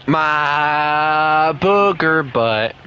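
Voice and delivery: slow, drawn-out doting coo. Real, not acted. Talking to his dog Booger, slow doting baby talk. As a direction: stretch "booger" out lovingly for the dog.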